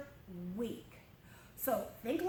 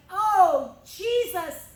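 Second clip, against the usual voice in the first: loud, high, emotional outburst; overwhelmed praise; 4 semitones higher, 7 dB louder, slower than usual. Retold or imitated. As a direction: cry out in overwhelming joy. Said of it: "threw himself on the ground".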